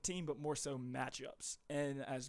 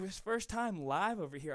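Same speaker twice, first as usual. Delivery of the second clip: breathless after a long stretch of talking; out of breath, blowing air out; 6 semitones higher, 10 dB louder, much slower than usual. Real, not acted. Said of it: "I'm just about out of breath".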